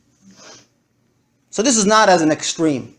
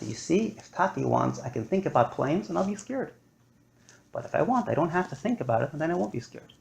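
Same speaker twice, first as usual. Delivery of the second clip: soft, calm, reassuring; father gently teaches his daughter, softly; gentle explanation; 12 dB quieter, faster than usual. Real, not acted.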